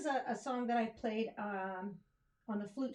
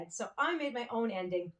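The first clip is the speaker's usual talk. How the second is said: ordinary talk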